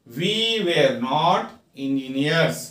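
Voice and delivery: very slow, each word apart; slow teaching read. Shown as a demonstration. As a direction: dictate it slowly to students. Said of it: "teacher reads model sentence".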